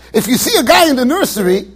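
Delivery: ordinary talk, nothing marked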